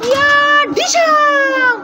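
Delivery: high squeaky mock fury; angry toy character. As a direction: play a cartoon character threatening a rival. Mother voices angry toy. (খেলনা চরিত্রের উঁচু গলায় নকল রাগ)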